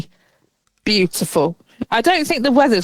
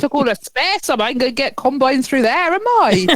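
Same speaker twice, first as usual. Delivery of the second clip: put-on higher, indignant Dorset farmer accent; local Dorset accent; 5 semitones higher than usual. Farmer blocked from harvesting, in a put-on Dorset accent. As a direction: complain in a broad rustic Dorset accent, rising at the end. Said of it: "they probably sound more like they would down here".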